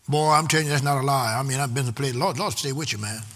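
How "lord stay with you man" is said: usual speaking voice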